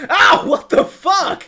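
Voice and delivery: loud, slow, high outraged ow; pained yell. Acted. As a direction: yell it out, stung and baffled. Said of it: "the guy just kind of goes".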